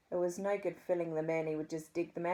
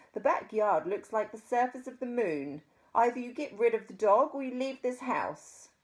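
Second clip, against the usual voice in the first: loud, fast, haughty society lady; posh lady voice; 4 semitones higher, 5 dB louder than usual. A narrator's reading. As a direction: demand it in a high posh tone. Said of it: "spoke in a posh voice".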